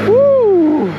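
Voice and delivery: loud high woo; excited whoop. Real, not acted. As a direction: whoop with delight. Whoop while accelerating on bike.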